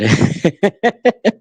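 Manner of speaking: a short high laugh of disbelief; a high, exasperated laugh; disbelieving laugh. Real, not acted.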